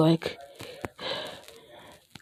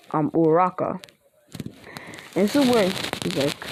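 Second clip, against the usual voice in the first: plain reading voice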